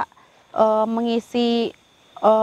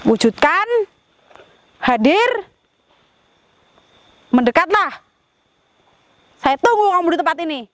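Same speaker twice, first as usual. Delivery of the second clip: summoning call; 6 semitones higher than usual. Real, not acted. Summons the spirit to appear.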